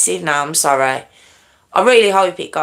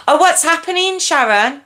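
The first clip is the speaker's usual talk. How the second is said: ordinary talk, nothing marked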